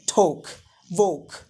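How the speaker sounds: "talk, walk" with long O vowels; British O sound. Shown as a demonstration. Teacher modelling British English, "talk, walk" with O. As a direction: say "talk, walk" like "toke, woke". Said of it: "it is O sound".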